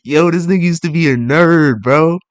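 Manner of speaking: loud, high-pitched hyped ribbing; hyped teasing. Real, not acted. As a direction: tease him loudly, dragging out the punch word. Hyped teasing about a friend.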